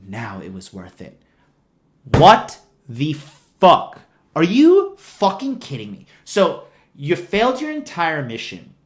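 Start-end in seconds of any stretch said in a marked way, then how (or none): 0.1-1.2 much softer, lower smug murmur; mock smug Rose. Mocking Rose after freeing the animal, soft smug murmur. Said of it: "she's like". 2.0-6.1 louder, drawn-out exasperated disbelief; exasperated outburst. Real, not acted. Reacting to the film's ending, loud exasperated outburst. Ranting at the scene.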